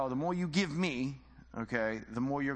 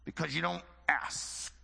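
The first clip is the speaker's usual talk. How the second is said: put-on Southern preacher accent. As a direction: scold the congregation in a hammed-up Southern preacher accent. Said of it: "he had a Southern accent".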